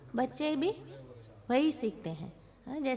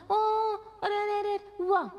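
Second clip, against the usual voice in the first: mock baby talk; 7 semitones higher than usual. Shown as a demonstration. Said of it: "आप वो हुआ नहीं बोलना".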